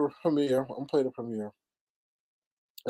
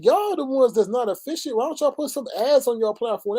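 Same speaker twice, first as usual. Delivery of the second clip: high-pitched, mildly indignant rant; retold exasperated scolding; 9 semitones higher than usual. Host retelling complaint to Stereo about ads, exasperated scolding. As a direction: retell berating them for incompetence, pitched up.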